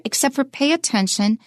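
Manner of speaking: usual speaking voice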